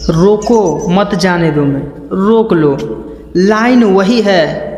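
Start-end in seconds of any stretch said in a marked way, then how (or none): 0.1-1.6 "रोको" alone, then "मत जाने दो"; pause after रोको. Shown as a demonstration. Coach returning to the hold-him meaning, pause after रोको. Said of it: "कहाँ आपको रुकना है".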